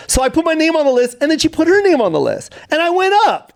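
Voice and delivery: very high-pitched, incredulous retelling; worked-up storytelling. Real, not acted. Comedian recounting her refusal to go up, worked-up. Excited climax of his story.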